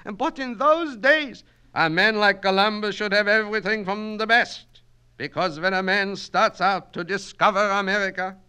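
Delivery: put-on high, loud Yiddish-accented orator; Yiddish-accented Kaplan, holding forth grandly; Yiddish-accented immigrant student. Student lecturing his night class in a Yiddish accent.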